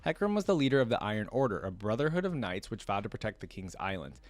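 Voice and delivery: usual speaking voice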